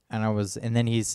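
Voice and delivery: usual speaking voice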